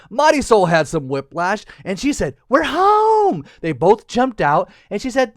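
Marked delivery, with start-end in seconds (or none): 2.5-3.5 high, loud drawn-out cheer; hammed-up happy homecoming; joyful cheer. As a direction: sing it out in triumph as a little girl. Girl cheers on landing.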